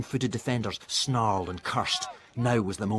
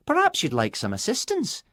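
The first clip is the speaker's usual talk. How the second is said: lightly voiced girl; 13 semitones higher, 4 dB louder than usual. A narrator's reading. Nina voiced by narrator.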